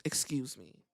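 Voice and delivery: whispered excuse me; whispered aside. Real, not acted. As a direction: slip in an apology under your breath. Correcting a swear.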